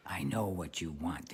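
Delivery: soft, low voice from a cloud; hushed voice of God. Acted. God in a cloud talks to the young man, hushed and low. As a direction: speak quietly and low, all-knowing.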